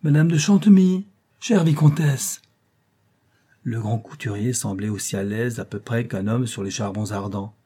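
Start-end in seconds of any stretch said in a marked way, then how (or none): 0.0-2.4 high, loud calling, slowing at the end; a dandy calling a lady loudly. A narrator's reading. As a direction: shout down the corridor. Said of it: "en criant".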